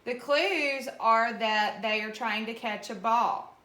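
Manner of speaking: usual speaking voice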